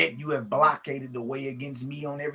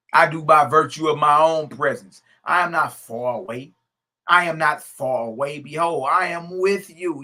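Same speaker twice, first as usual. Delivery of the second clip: proclaiming scripture; 2 semitones higher, 7 dB louder than usual. Acted. Preacher reading the creator's words to his audience, proclaiming. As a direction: read it as the creator's decree.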